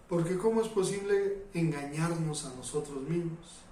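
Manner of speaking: ordinary delivery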